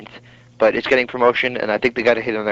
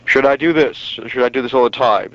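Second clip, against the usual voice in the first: announcer voice. Shown as a demonstration. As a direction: keep the broadcaster voice going. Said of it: "What do you think of this voice?".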